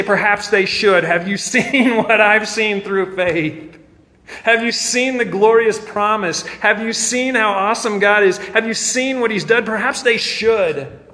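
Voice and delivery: high, loud, impassioned challenge; fervent reply. Acted. Believer answering those who say people don't talk like that, fervent. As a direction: press each question home with rising fervor.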